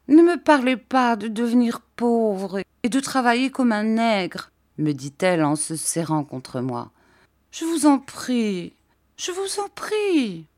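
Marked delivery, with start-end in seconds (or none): 0.1-4.5 mildly alarmed, higher-pitched plea; a touch of childlike distress; pleading. A narrator's reading. Frightened Dora begs narrator. 7.5-10.4 put-on high, drawn-out childlike begging; plaintive little-girl entreaty; anxious pleading. A narrator's reading. Dora begs her fiancé.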